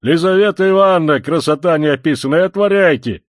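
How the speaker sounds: loud, high call through a door; impatient yelling. Acted. Visitor calling to the women behind a locked door, impatient yell. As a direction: yell to be let in.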